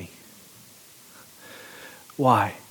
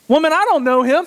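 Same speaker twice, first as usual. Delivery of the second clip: panicked denial; 10 semitones higher, 3 dB louder, faster than usual. Acted. Peter's denial acted out.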